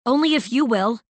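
loud, high retort; arguing. A narrator's reading. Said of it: "she argued".

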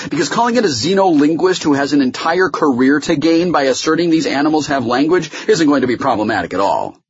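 high-pitched, incredulous sarcasm; scornful challenge. Acted. Meyer challenges Holloway about translating, with scornful sarcasm. Opposing lawyer mocks the witness plan.

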